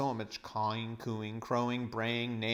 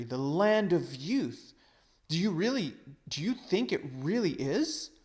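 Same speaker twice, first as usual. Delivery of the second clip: hopeful cry; 6 semitones higher, 5 dB louder than usual. Acted. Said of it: "cried Diggory".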